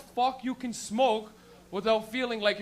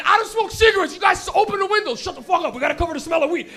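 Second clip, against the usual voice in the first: louder, higher frantic shout; panicked shouting; 6 semitones higher, 9 dB louder than usual. Retold or imitated. Kid in the back seat panicking at friends, shouting.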